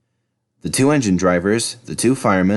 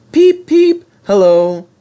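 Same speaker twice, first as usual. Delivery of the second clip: high whistle voice; 11 semitones higher, 8 dB louder, slower than usual. Acted.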